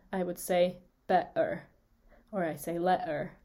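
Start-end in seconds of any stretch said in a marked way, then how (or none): 1.1-1.6 "better" with a catch in the throat for the T; glottal stop. Shown as a demonstration. Teacher demonstrating British speech, glottal stop. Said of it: "what we call a glottal stop". 2.8-3.3 "letter" with a throat catch replacing the T; glottal stop. Shown as a demonstration. Teacher giving another example, glottal stop. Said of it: "what we call a glottal stop".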